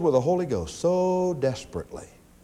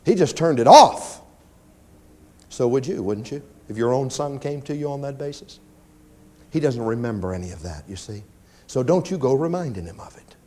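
normal talking voice

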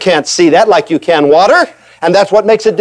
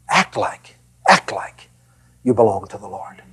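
hushed earnest appeal; 10 semitones lower, 9 dB quieter than usual. Real, not acted. Preacher's quiet earnest appeal.

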